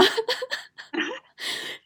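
high breathy burst of laughter. Real, not acted. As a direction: laugh at her own misfortune. Laugh after retold question. (高声笑出来)

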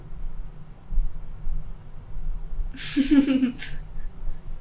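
a long breathy giggle; giggling laugh. Real, not acted. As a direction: laugh it off, half embarrassed. Teen laughs at question. (咯咯笑)

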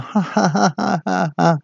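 high hammed-up villain cackle. Manager cackles at his staff, hammed-up villain laugh. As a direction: cackle like a cartoon boss.

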